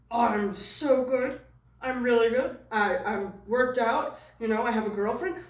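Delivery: a pleased, boastful little puppet showing off; puppet's own voice. Acted. Puppet bragging to his puppeteer, in his own character voice. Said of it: "he has also his own voice".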